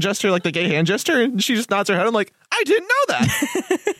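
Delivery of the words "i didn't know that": high, drawn-out mock astonishment; retold surprise. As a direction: replay your startled disbelief way up high. Retelling own startled reaction.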